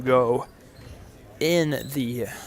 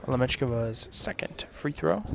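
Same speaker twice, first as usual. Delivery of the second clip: softer, held-back call; hushed golf call; 2 semitones lower, 9 dB quieter than usual. Acted. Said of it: "talking like a golf commentator".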